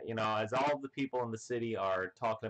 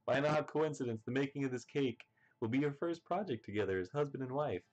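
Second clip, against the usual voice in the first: lightly voiced announcer; 4 semitones higher, faster than usual. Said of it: "the next panel we see".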